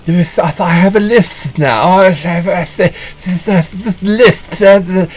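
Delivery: exaggerated lispy actor parody; mocking Mark Ruffalo's lisp. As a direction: lisp it out in a hammed-up impression. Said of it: "when the camera's close up".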